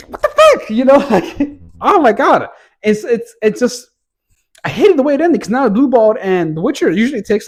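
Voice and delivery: high, loud exasperated rant; frustrated squeal. Real, not acted. Reviewer vents at the cliffhanger in a high frustrated squeal. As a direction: squeak out your outrage at the ending.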